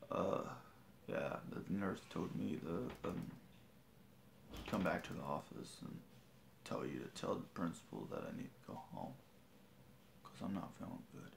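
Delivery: soft, fading sick voice. Retold or imitated. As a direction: trail off weakly like you're ill. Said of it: "This is what I sound like when I'm sick".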